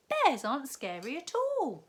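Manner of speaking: put-on a girl scoffing at fear; Katy's voice. A narrator's reading. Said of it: "said Katy".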